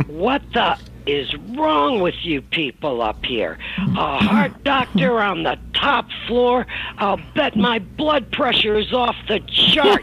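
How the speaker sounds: high, loud outraged rant, slowing at the end; mother ranting. Retold or imitated. Mother arriving with sword and cane, shouting at office staff. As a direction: tear into the whole office.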